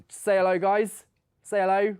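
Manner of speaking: loud, cheerful call to the room. Real, not acted. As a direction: call across the studio. Calling out to the team.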